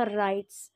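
राइट्स said with a plain s sound, "raits"; teacher modelling राइट्स with a crisp final s; correct pronunciation. Shown as a demonstration. Teacher modelling the correct sound for students, careful pronunciation. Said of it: "सही pronunciation होगा".

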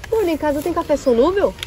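normal talking voice

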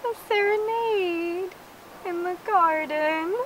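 slow dreamy princess sigh-talk; swooning delight. Acted. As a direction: melt over a romantic surprise. Princess delighted by minstrels' serenade.

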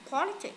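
"politic" with a high PO at the start; stress on first syllable. Shown as a demonstration. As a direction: hit the opening of politic. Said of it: "Politic nhấn âm đầu này".

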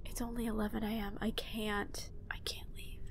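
breathless hushed whisper; despairing whisper. Real, not acted. Gamer trapped in the mine, despairing whisper. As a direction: whisper it in dread.

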